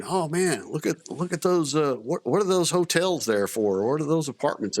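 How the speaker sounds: high, wide-eyed disbelief at the buildings; amazed soldiers. Retold or imitated. Soldiers gawking at Air Force housing, high amazed voice. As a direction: gawk like a new arrival seeing luxury. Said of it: "they're looking over and, and seeing".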